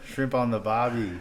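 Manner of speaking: mock Aussie catchphrase voice; hammed-up Australian imitation; Australian accent. Shown as a demonstration. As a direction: play up a cartoonish Australian accent. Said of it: "Let's hear the Australian accent".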